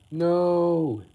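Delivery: loud, high cry of "No!"; horrified shout. Acted. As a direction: yell it as the plan goes wrong. Hero shouts in horror.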